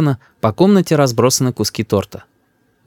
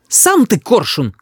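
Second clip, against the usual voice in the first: high, slow indignant retort; high-pitched offended cry; mother shouting; 10 semitones higher, slower than usual. A narrator's reading. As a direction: fling the insult back. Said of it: "кричит мама".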